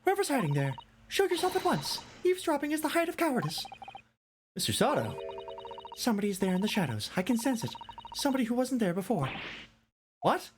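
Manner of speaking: put-on high, bright girl's voice calling into the shadows; a plucky young girl, alarmed but bold; girlish voice. A girl calls out, then whispers.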